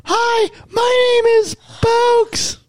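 very high, slow squeaky dog voice. Pretend. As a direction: shout it like a tiny excited puppy. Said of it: "Is that how Bulks sound?".

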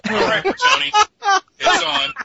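sounds like talking into a can; tinny, boxy mic sound; tin can phone. Real, not acted. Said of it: "You sound like you're talking into a can".

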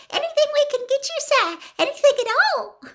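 very high, fast, squeaky house elf; house elf squeak. A narrator's reading. Fred imitating a house elf for Hermione, high squeak. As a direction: squeak it eagerly like a servile elf. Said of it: "put on a high-pitched squeak and imitated a house elf".

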